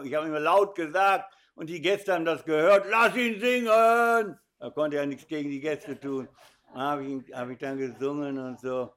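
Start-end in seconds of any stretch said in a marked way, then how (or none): 2.9-4.4 high, loud, slow crowd yell; shout. Retold or imitated. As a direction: bellow it like the pub crowd. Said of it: "dann schrien die immer". (lauter Ruf der Gäste)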